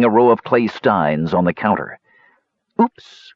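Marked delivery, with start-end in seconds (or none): 2.8-3.3 high startled cry; high oops. Acted. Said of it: "cried Talaran".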